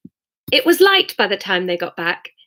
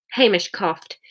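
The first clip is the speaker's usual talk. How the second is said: ordinary delivery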